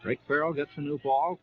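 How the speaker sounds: normal talking voice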